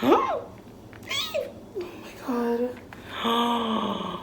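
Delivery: sharp gasp, then high amazed oh my God; gasping surprise. Real, not acted. Unwigging the doll, gasping in amazement. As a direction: gasp as if stunned by beauty. Gasp on seeing doll's hair.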